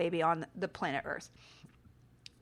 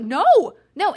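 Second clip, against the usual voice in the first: loud, higher cry of no; retold panicked yell; 7 semitones higher, 12 dB louder than usual. Mum yelling at her toddler heading for the open window, panicked loud. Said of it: "I'm like".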